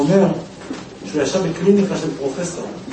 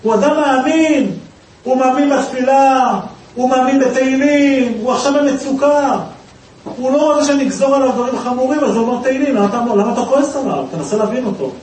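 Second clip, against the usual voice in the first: pleading self-talk; 7 semitones higher, 3 dB louder than usual. Retold or imitated. Judge arguing with himself to calm down, pleading. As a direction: talk yourself down, insistently. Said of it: "אני הרגעתי את עצמי".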